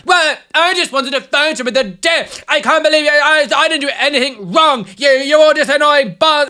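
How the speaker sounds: hammed-up huffy protest; mock entitled woman whining. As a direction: play the offended lady who did nothing wrong. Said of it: "And then she was like".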